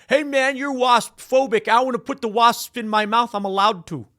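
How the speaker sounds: high, loud whiny-mock complaint; indignant protester. Man wanting wasps in mouth, mock indignant. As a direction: protest like a put-on indignant fool.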